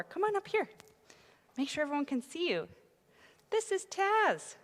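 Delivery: high coaxing voice to the dog; talking to a dog. Real, not acted. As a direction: call the dog up sweetly. Pastor calls her dog onstage.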